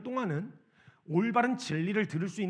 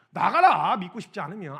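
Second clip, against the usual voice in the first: furious command to leave; preacher imitating kids shouting get out; angry shout; 9 dB louder than usual. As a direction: yell it, much louder, telling them to get out. Kids storm out, acted fury.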